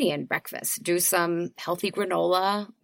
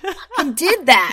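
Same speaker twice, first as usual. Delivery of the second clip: high, loud triumphant cry; triumphant cheer; 8 semitones higher, 8 dB louder than usual. Retold or imitated. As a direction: shout out a proud win after a workout. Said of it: "you're like".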